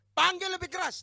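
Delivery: high, loud jeering call; shouted challenge in a preacher's acted quote; Elijah's taunting shout. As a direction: taunt the crowd at full voice. Acts Elijah taunting false prophets.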